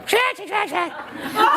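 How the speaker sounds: sudden high burst of laughter; real laugh. Real, not acted. Laughing as he recalls the breakup story. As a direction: laugh at the memory. Audience asked about Isabella story.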